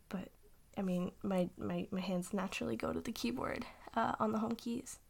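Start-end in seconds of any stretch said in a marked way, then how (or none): none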